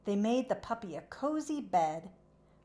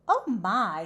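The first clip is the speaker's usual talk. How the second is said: ordinary delivery, nothing marked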